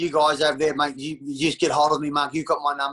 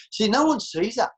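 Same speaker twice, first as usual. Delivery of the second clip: ordinary talk, nothing marked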